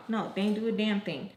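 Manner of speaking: ordinary talk, nothing marked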